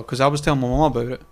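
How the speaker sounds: quick, hurried run through the words; too fast. Shown as a demonstration. Podcaster mentioning a chat with mum, talking too fast. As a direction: rattle it off at a fast clip. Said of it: "sometimes I talk too fast".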